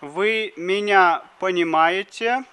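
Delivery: usual speaking voice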